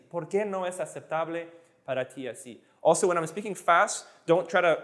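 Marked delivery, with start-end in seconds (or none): 0.1-2.6 softer, slightly slower repeat. Shown as a demonstration. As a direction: ease through it with a short pause. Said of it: "slow again".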